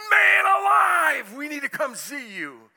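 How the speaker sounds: loud, high, slow panicked yell; husband calling his counselor, shouting in distress; frantic shout. Retold or imitated. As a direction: burst out like a man at the end of his rope. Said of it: "I get a call from him".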